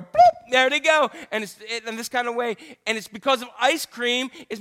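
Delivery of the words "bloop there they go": loud, slow popping bloop; cartoon sound effect bloop; comic bloop. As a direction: make the flab pop out. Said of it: "the love handles start to go".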